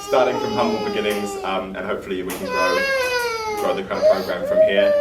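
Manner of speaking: words squeezed out through giggles; laughing. Real, not acted. Host presenting the program to families, laughing through it. Still laughing while talking.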